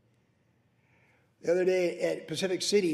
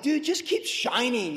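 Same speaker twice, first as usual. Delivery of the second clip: high, slow, pep-talk voice; encouraging send-off; 6 semitones higher, slower than usual. Retold or imitated. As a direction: cheer him on, drawn out. Encouraging the young man.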